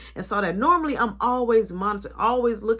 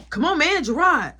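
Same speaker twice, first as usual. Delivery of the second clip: louder, higher call to wake him; loud urging call; 5 semitones higher, 6 dB louder than usual. Retold or imitated. Boss shouting at his worker asleep in the office to get up. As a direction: rouse him, come on man. Shaking sleeping worker awake.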